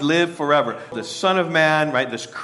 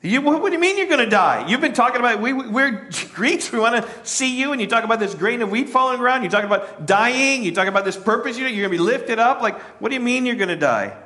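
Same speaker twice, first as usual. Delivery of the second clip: higher, fast puzzled questioning; a hint of a confused crowd asking Jesus; 8 semitones higher, faster than usual. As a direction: voice the bewildered crowd. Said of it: "they understood".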